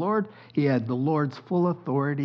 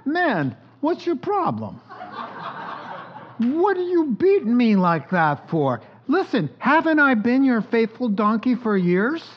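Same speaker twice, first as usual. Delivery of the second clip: high, indignant donkey voice, pitched well up; talking donkey; 7 semitones higher, 3 dB louder than usual. Acted. Beaten donkey scolding Balaam in a high indignant voice. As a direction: play the wronged donkey scolding her master, high. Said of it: "The donkey turns her head and says".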